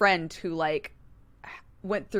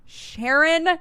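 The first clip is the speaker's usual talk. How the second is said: high, louder, fed-up call of Sharon; exasperated Sharon; 8 semitones higher, 4 dB louder than usual. Retold or imitated. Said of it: "I'm like".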